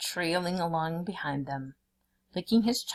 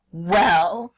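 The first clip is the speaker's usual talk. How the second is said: impatient tiger; 8 dB louder than usual. A narrator's reading. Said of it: "cried the tiger impatiently".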